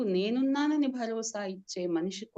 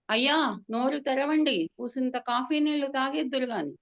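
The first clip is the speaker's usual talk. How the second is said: higher, louder coaxing call; 5 semitones higher, 4 dB louder than usual. Acted. Servant coaxes the bedridden master to drink, loud call. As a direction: shout gently to coax him. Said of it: "గట్టిగా అరుస్తూ చెప్తున్నాడు".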